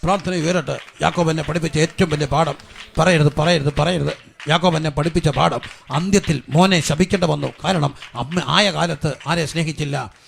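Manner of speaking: loud, driving revival sermon; forceful preaching. Real, not acted. Preacher urging families to take back curses, forceful preaching. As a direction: preach at full force, pressing for repentance.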